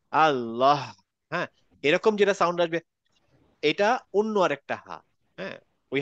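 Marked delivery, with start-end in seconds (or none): 0.1-0.8 Allah ending in the other, stronger 'ha' sound; wrong final ha. Shown as a demonstration. Teacher shows students the sound to avoid, আল্লাহ with wrong final হ. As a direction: say আল্লাহ with a forceful breath on the final হ. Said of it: "এরকম sound না আসে".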